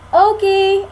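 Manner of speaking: loud, high call; shouting. A narrator's reading. Annie shouting for the puppy in the woods. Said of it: "Annie shouted".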